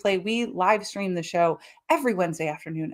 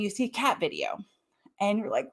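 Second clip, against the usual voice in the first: usual speaking voice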